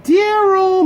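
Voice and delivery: high, loud call across the yard; mom yelling. Retold or imitated. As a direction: yell the name like a mother calling a kid in. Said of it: "my mom would yell out".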